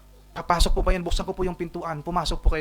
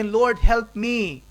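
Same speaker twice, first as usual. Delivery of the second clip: slow, higher desperate cry for help; pleading woman; 7 semitones higher, 6 dB louder, much slower than usual. Acted. Kneeling mother begs Jesus for help, pleading. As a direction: beg the Lord on your knees. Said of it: "At sabi".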